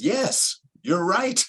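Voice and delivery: high-pitched, exaggerated agreement; comically over-the-top agreement; emphatic mock concession. Playfully concedes to self-righteous person.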